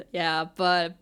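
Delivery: ordinary delivery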